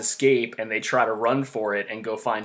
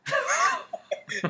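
laughing; 3 dB quieter than usual. Real, not acted. Laughing after movie description.

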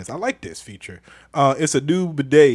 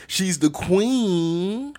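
high, long-held "queen"; drawn-out hype; 8 semitones higher, much slower than usual. Real, not acted. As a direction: sing out "queen" as a slow, swooping tribute. Hyped shout-out to Stephanie.

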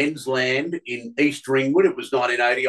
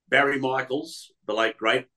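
normal talking voice

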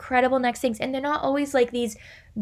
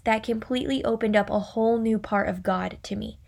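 usual speaking voice

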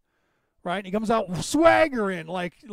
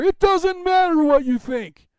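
slow, much higher and louder wrestler's taunt; The Rock impression; 8 semitones higher, 8 dB louder, slower than usual. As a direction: sneer it like a wrestling heel calling someone out. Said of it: "when he's playing that character".

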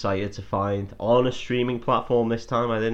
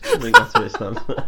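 laughing through the joke, then a high squeal; words spilling out through real laughter; 6 dB louder than usual. Real, not acted. Joke cracks him up.

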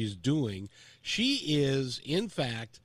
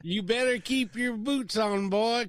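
mock Southern cowboy; 9 semitones higher, 5 dB louder than usual. Host keeps cowboy bit going.

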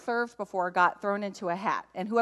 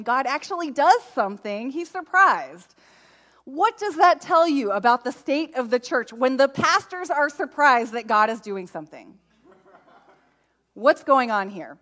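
emphatic preaching; 4 semitones higher, 5 dB louder than usual. Acted. Preacher's rhetorical climax.

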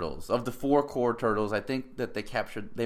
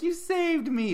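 much higher, breathless gushing; mock swooning girl; slower than usual. Girl hugging her boyfriend, high mock swoon. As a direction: gush it like a rescued girlfriend.

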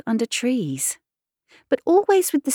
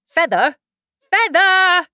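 rising sheep's call, louder on the second; 11 semitones higher, 5 dB louder than usual. Acted. Sheep calling the rowing instruction to Alice. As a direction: shout the order across the boat.

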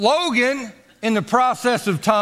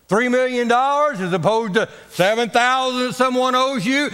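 ordinary talk, nothing marked

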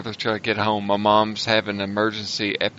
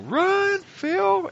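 high shouted call to run; yelling. Retold or imitated. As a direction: yell it high and urgent. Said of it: "I yell".

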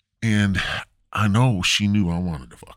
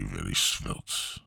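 much lower and softer, slow: "very svelte"; low muttered repeat; 12 semitones lower, 10 dB quieter, much slower than usual. Shown as a demonstration. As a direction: drop "very svelte" down low and quiet. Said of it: "I mean, very".

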